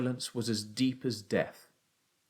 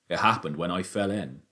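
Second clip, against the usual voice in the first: injured father; 4 semitones lower than usual. A narrator's reading. Father tells son about his fall, contained. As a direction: lower adult voice.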